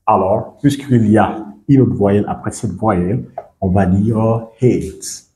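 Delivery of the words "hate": "hate" with the vowel like the letter name A; English long A. Shown as a demonstration. Teacher shows the vowel taking its English sound, long a in "hate". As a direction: say "hate" with ay, falling tone. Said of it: "Ça va prendre son son en anglais".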